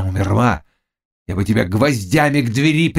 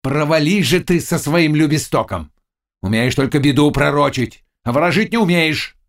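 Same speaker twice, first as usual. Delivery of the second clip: raised-pitch irritated rebuke; annoyed scolding; 7 semitones higher than usual. Acted. Cossack dismissing the witch's useless remedy, irritated. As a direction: tell the fortune-teller off.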